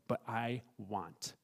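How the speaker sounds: soft, drawn-out self-willed quote; wilful wanting. Acted. Preacher quoting Herod's defiance of God's truth, slow wilful wanting. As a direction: stretch each word slowly and quietly, voicing wilful desire. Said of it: "Herod said".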